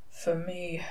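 very soft, under the breath; hushed echo of the song line; murmured. A narrator's reading. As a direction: murmur it, barely voiced. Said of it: "murmured Crowley".

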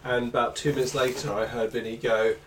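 ordinary talk, nothing marked

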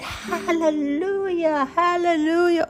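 very high, drawn-out mock exultation; loud, high-pitched gleeful quote; imitated hysterical glee. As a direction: mimic a woman crying out in delight. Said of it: "she started laughing hysterically. She said".

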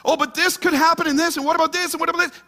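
high, fretful spiral of worries; mock worrying. Preacher mimicking a congregant's anxious what-ifs. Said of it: "struggle with that? awfulizing".

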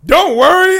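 high, loud hammed-up hero line; mock heroic reassurance. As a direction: ham up the cool entrance. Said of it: "was like".

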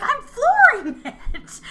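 very high, loud, slow, laughing shriek; shrieked insistence. Retold or imitated. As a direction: scream it through laughter. Marta in slow car chase.

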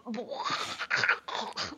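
a silent, airy burst of laughter; stifled giggle; breathy laugh. Real, not acted. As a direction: laugh under your breath, nearly soundless. Reacting to "champ.

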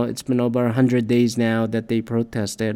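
ordinary talk

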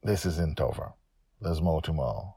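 usual speaking voice

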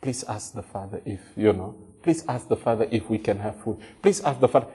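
put-on soft timid child begging a brother; pleading child. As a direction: plead meekly through a sibling. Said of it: "Everyone else talks to that brother".

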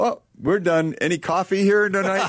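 high, breezy offhand voice; mock casual prayer team. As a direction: toss it off lightly, oblivious. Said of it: "And they go".

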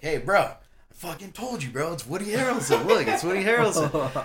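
high, insistent told-you-so to a buddy; excited vindication. Retold or imitated. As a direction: act out pointing out the star, excitedly. Retelling triumphant second sighting.

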